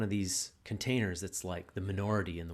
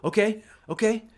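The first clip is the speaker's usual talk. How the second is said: higher, louder egging-on voice; coaxing and hyping a buddy to push; encouraging hype; 6 semitones higher, 5 dB louder than usual. Retold or imitated. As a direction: pump him up to bring the anger. Voicing a supportive man inviting anger.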